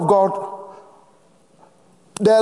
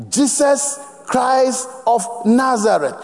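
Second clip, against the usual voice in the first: ordinary delivery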